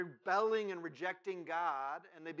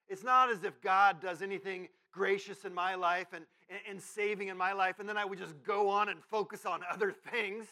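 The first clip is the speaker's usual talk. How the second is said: loud, heavily ironic protest; sarcastic; 3 semitones higher, 10 dB louder than usual. Acted. Preacher mocking his own fickleness before God, loudly sarcastic. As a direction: insist on it while meaning the opposite. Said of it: "That's sarcasm".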